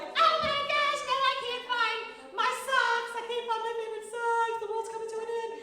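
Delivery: high, loud, hammed-up wailing; mock panic. Teacher acting out losing favorite socks, mock panicked meltdown. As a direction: wail it as if a trifle were catastrophe. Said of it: "And then a few minutes later, you're like".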